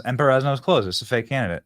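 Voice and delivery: ordinary talk, nothing marked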